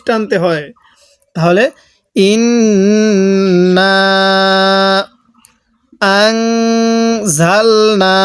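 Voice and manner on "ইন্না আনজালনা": ইন্না with a held nasal ghunna on the n, stretched slow. Shown as a demonstration. Teacher demonstrates ghunna on ইন্না for students. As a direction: hum the নুন of ইন্না through the nose, then আনজালনা slowly. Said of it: "এই নুন সাকিনের মধ্যে গুণনা করব".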